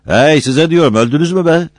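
high, loud demanding yell; shouting. A narrator's reading. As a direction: holler at a crew that won't answer. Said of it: "bağırdı". (yüksek sesle, tiz bağırarak)

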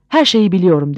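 ordinary delivery, nothing marked

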